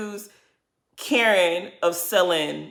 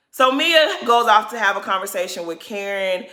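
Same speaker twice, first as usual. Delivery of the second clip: normal talking voice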